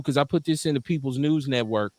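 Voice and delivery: ordinary talk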